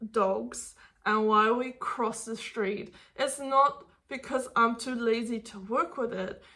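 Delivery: ordinary talk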